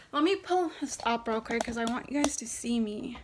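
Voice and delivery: usual speaking voice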